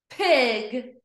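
PIG joined in one go; blended word. Shown as a demonstration. Teacher blending for pupils, PIG as one word. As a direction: say PIG whole. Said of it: "read each word fast".

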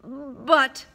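a hint of a stammered protest; Fudge, stuttering. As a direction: cut in, faltering. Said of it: "stuttered Fudge".

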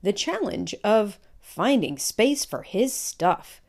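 lively picture-book read-aloud; reading to children. Acted. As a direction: tell it like a storybook to a class.

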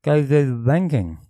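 tongue held, words come out mangled; holding tongue with fingers. Shown as a demonstration. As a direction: say it while pinching your tongue. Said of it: "No, hold your tongue and say it".

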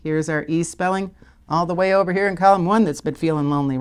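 ordinary delivery

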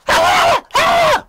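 very high, loud wordless shriek; imitated screech at full volume; mock screaming. Woman going off on a train passenger, imitated screaming.

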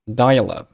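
firm dial-up with a swallowed final p; unreleased p. Shown as a demonstration. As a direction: stress dial-up but don't pop the p. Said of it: "dial-up结尾的p并没有爆出来".

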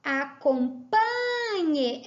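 "Acompanhe" slow, PA stretched long; stress on PA. Shown as a demonstration. Teacher showing the tonic syllable, PA drawn out in "Acompanhe". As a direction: hold the PA in "Acompanhe". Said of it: "é o pan".

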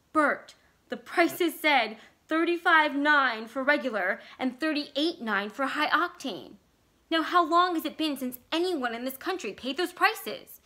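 pressing the point, spooked; wife pressing husband about prices, agitated; agitated plea. Acted. Vicky agitated about old prices.

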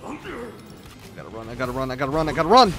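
repeated escape chant building to a shout; building urgency. Real, not acted. Player fleeing in the game, urgency building to a shout.

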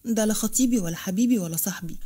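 ordinary delivery, nothing marked

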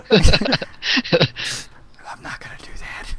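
unvoiced whisper. Real, not acted. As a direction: whisper it under your breath. Whispered aside.

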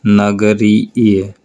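very slow, each sound held; teacher's slow model of a word; slow demo word. Shown as a demonstration. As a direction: draw out the ending for the class. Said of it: "जैसे नगर होता है".

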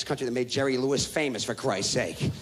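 ordinary talk

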